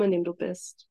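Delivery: normal talking voice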